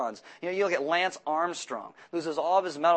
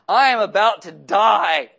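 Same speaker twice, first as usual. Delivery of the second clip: loud, slow overdone despair; melodramatic wail; 3 semitones higher, 10 dB louder, slower than usual. Retold or imitated. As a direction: overplay dying of hunger. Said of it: "It's exaggeration".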